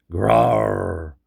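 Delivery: loud, growling monster roar. A narrator's reading. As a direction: roar right in his face like a beast. Monster roars in his face.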